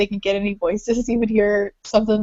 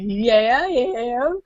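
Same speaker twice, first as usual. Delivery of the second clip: put-on Texan accent; 3 semitones higher, much slower than usual. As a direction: fake Texan twang, lifting at the end. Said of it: "try to do the accent".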